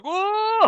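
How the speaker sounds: high, drawn whoa; imitated alarmed yell. Two scientists dragged along the tube, imitated alarmed yell. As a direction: cry out as if being dragged.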